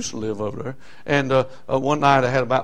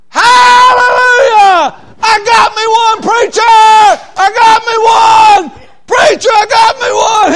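high, slow, ringing hallelujah shout; joyful shout; 13 dB louder, slower than usual. Retold or imitated. Song leader shouting to the preacher after his first convert, ecstatic. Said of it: "He said".

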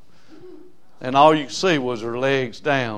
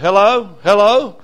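louder, higher imitated hello; calling down the phone; 7 semitones higher, 10 dB louder than usual. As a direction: call out to check someone is on the line.

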